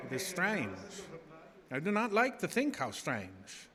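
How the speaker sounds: ordinary talk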